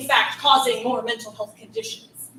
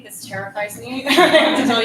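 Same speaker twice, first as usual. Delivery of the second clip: nervous laugh; 8 dB louder than usual. Real, not acted.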